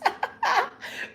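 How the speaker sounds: high squealing laugh; amused high-pitched laugh. Real, not acted. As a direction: burst out in a high cackle. Laughs at a sponsor joke.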